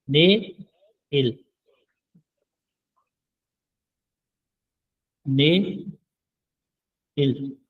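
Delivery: ने and इल said apart with long pauses; syllable by syllable. Shown as a demonstration. Korean teacher drilling ने इल syllable by syllable. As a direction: split ने इल slowly. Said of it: "tomorrow को बोलते हैं ने".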